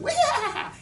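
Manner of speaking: high comic yelp with a laugh; the bone cries out as a pun; cartoon yelp; high squeal "Wah!" of the humerus. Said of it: "the humerus is going".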